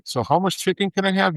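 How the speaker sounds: high, pleading mock question; whiny dieter question. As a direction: ask like a confused dieter begging for rules. Julio mocks his own dieter questions.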